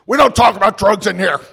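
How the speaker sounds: a hint of an angry old-timer scolding; gruff old drunk's shout. Old drunk stomps up to him.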